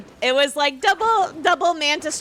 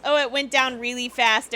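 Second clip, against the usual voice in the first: ordinary talk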